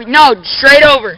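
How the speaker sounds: slow, loud called-out direction; shouting instructions. Real, not acted. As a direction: yell it across the park. Shouting instructions across a skatepark.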